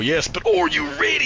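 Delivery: high hammed-up challenge; mock Bonesaw taunt. Friend voices Bonesaw challenging Thanos, high and hammy. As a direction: wrestler hyping the crowd.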